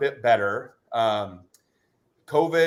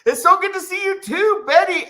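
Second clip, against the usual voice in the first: excited greeting; 13 semitones higher, 6 dB louder than usual. Real, not acted.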